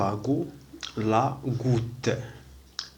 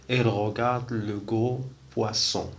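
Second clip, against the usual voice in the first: very slow, every French word laid out; slow model reading; much slower than usual. Shown as a demonstration. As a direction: spell the sentence out slowly for beginners. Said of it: "عندنا فوا سي". (قراءة بطيئة جدًا للجملة الفرنسية)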